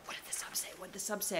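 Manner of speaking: hushed, unvoiced, fretful repeating; anxious whisper. Host imitating themselves as a teacher awaiting sub's note, anxious whisper. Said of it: "I'd be so anxious".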